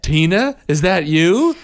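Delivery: put-on high, loud excited kid's call; little brother greeting the cheese woman, high and loud; excited child voice. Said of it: "Bryn started going".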